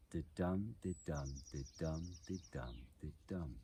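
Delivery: soft da-dum pulse, DUM stressed; heartbeat rhythm. Shown as a demonstration. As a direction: tap out five quiet da-dums, weak-strong, like a heartbeat. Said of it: "it is the heartbeat".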